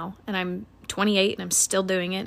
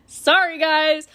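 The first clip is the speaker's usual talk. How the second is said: laughing apology; 6 semitones higher, 6 dB louder, slower than usual. Real, not acted.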